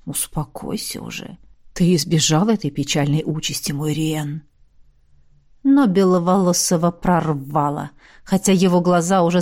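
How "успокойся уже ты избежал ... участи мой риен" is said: soft breathy calming voice; calm him gently; soothing. A narrator's reading. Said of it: "успокаивающе дунул холодом". (успокаивающе)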